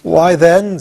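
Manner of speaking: a sudden surprised start. A narrator's reading. Pumpkinhead realizing Tip made him, surprised. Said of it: "in a tone that expressed surprise".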